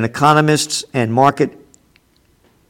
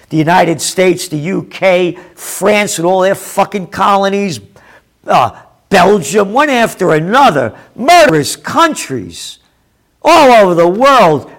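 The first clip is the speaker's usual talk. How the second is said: rising into a furious shout, higher and louder; angry rant; 5 semitones higher, 4 dB louder than usual. Real, not acted. Commentator rages at colonial powers, building to a shout.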